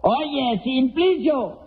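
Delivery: high, slow-voiced test question; high hailing question; calling to the deaf. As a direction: pitch it right up so the deaf man hears. Testing a deaf man's hearing.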